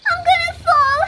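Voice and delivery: panicked shout; panicked cry. Acted. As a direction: cry it out as the branch slips. Repeated cry, second more urgent.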